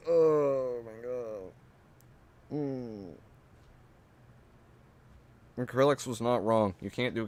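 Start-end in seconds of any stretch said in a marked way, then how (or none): 0.1-3.1 very slow, drawn-out despair; exasperated sigh. Acted. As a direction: groan it out.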